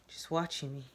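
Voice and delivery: ordinary delivery, nothing marked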